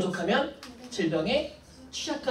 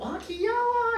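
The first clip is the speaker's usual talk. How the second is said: very high squeal of "so cute"; mock cutesy gushing; slower than usual. Teacher mimics friend gushing over a word, high cutesy squeal. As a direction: imitate a friend cooing, pitched way up.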